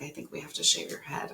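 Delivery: imitating a hushed whisper. Hairdresser friend breaking the news, whispered. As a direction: breathe it gently like bad news. Friend's gentle verdict retold.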